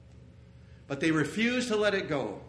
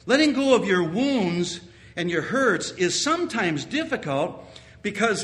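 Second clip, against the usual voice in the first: ordinary talk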